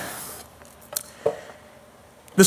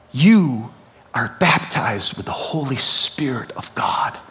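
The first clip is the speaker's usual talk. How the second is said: whispered declaration; a reverent whisper to each listener; 8 semitones lower, 4 dB quieter, slower than usual. Real, not acted. Intimate sermon climax.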